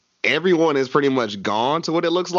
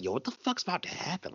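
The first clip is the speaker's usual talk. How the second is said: breathless, hushed and higher, spooked; hushed scared; 6 semitones higher, 12 dB quieter than usual. Retold or imitated. A fan spooked by the ranch scene, hushed and higher. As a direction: hush it like you're scared of the screen.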